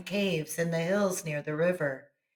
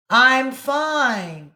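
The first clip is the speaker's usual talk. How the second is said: higher, louder called reply; called answer; 7 semitones higher, 8 dB louder than usual. A narrator's reading. Boy answering the echo, called out. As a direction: call back your answer. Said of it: "Johnny answered".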